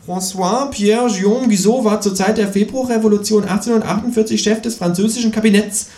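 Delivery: high quiz-show lecturing; know-it-all. A narrator's reading. Kangaroo scoring with trivia, high and smug. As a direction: rattle off the facts smugly. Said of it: "mit seinem Trivial Pursuit Wissen".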